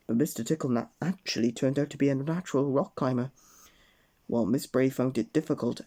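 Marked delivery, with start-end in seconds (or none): none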